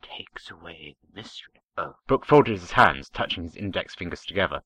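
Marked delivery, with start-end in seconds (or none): 0.0-1.6 hushed, unvoiced-sounding secretive whisper; conspiratorial whisper. A narrator's reading. Captain to the shepherd over coffee, conspiratorial whisper. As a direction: drop right down into a conspirator's whisper. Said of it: "his voice turning to a conspiratorial whisper".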